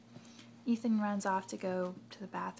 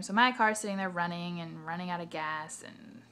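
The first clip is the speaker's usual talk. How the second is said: normal talking voice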